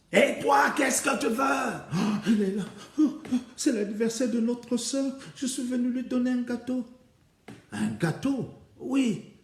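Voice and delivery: high, loud, challenging voice; suspicious Février. Acted. Février chasing the poisoner sister away, high and loud. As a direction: bark at the intruder, then laugh her off.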